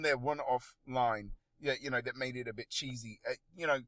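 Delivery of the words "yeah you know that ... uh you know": normal talking voice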